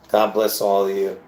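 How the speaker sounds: ordinary talk, nothing marked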